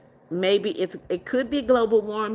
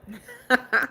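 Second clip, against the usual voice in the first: short real chuckle; a brief laugh to yourself; amused laugh; 4 semitones higher than usual. Real, not acted. Streamer amused by what a viewer sent, short laugh.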